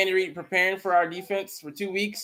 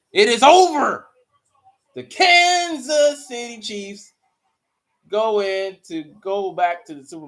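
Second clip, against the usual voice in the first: announcer call; 7 dB louder than usual. Acted. Calling the game result.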